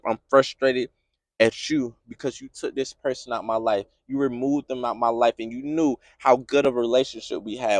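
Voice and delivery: low, hushed lament to God; pained prayer. Acted. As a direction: plead softly, frustrated and lost.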